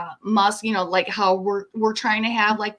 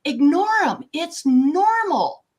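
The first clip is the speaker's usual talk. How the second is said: mocking the official line; 7 semitones higher, 3 dB louder, slower than usual. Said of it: "we have been told in the news".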